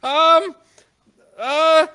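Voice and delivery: very high, loud, slow squeaky clerk; panicky clerk voice. Acted. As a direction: squeal it like a flustered little clerk. Clerk voiced by game master.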